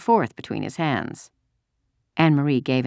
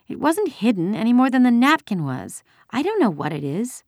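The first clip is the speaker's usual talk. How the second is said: put-on high-pitched, defensive child; upset young girl; 9 semitones higher than usual. A narrator's reading. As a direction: answer the soldier as an upset little girl. Tearful girl answering the soldier.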